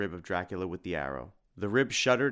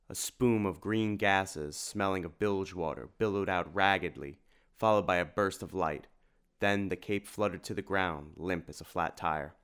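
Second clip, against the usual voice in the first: ordinary delivery, nothing marked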